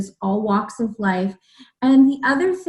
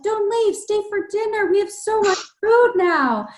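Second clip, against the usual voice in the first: put-on high-pitched little boy pleading; lightly voiced eager little boy; child's voice; 10 semitones higher than usual. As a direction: lift the voice high like a small kid begging. Child's voice in retold story.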